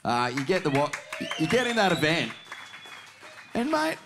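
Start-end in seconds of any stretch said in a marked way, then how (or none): 1.4-4.0 rising, building hype; excited. Real, not acted. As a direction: hype it up, voice climbing. Host hyping guest's result.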